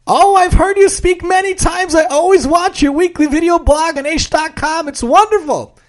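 high, gushing fan voice; young woman voice. Acted. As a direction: gush like an admiring fan. Cashier gushing.

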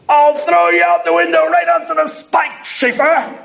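high, put-on teacher's threat; imitating the Rebbe. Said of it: "There was a Rebbe who used to say this".

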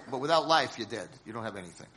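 usual speaking voice

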